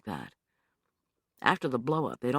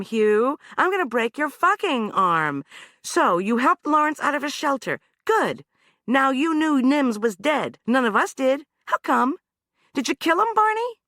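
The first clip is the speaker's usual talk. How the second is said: high, loud, relentless grilling; hard interrogation; 12 semitones higher, 5 dB louder than usual. Acted. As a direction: corner him with each question. Anna interrogating, pressuring Hugh.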